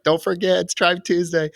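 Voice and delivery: high, loud, bubbly mock announcement; excited reminder. Friend imitating Mary Liz kicking off the weekly text, bubbly and high. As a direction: chirp it out like a cheerful group alarm.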